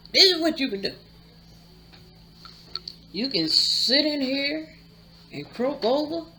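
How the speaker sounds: lightly voiced father laying down the law; stern father's order. Father telling the poisoned man his choices, lightly stern order.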